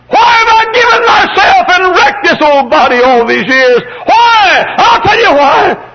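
very high, loud, impassioned pulpit cry; shouted sermon at full voice; full-force preaching. Real, not acted. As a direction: cry out each question from the pulpit.

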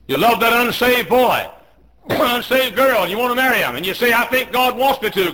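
high, loud shouted sermon; full-force preaching. Real, not acted. Preacher warning teenagers about temptation, full-force shout. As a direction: shout each phrase to the back pew.

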